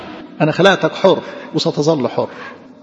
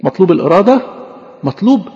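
ordinary delivery

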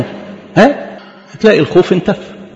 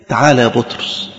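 soft voice of Jesus; 7 semitones lower, 5 dB quieter than usual. Acted. Jesus calling Peter.

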